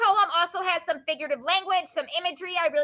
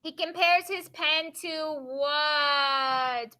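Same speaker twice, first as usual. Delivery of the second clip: slow, with a long drawn-out final word; leading question; much slower than usual. Real, not acted. Teacher prompting the class to fill the blank, drawn-out question. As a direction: stretch "what" out and wait for the answer.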